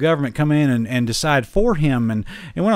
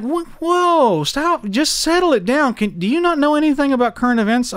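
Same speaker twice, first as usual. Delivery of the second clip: mock exasperated plea; 13 semitones higher than usual. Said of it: "it's like".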